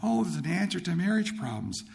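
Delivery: high, excited oh of realization; light up at finding the answer; retold delighted discovery. Bible student finds answers to marriage problems, excited.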